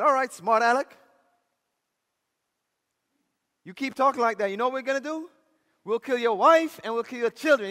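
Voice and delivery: loud, high acted judge; preacher playing a riled official; angry threat.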